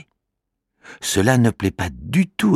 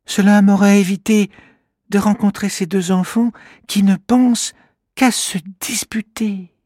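high small voice, regretful; rueful little creature; 4 dB louder than usual. Acted. Kimamila regretting he came, rueful creature voice. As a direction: grumble to yourself. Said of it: "pense le petit bonhomme".

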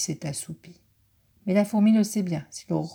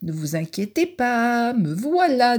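higher, louder elk voice; confident elk; 6 semitones higher, 5 dB louder than usual. Acted. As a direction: arrive with a bold promise. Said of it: "dit un élan qui arrive".

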